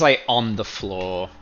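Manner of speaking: ordinary delivery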